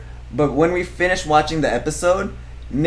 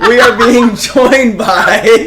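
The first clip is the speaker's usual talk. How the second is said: laughing; 5 semitones higher, 7 dB louder, slower than usual. Real, not acted. Host cracking up on live intro.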